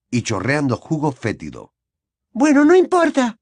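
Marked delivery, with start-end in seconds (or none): 2.3-3.4 put-on very high, louder cheerful girl; brightly upbeat girl voice; optimistic. A narrator's reading. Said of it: "dijo Ginny con optimismo".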